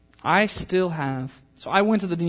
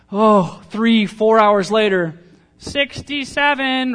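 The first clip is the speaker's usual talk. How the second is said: weary clerk call; 6 semitones higher, 10 dB louder, slower than usual. Retold or imitated. Mock DMV wait, number called.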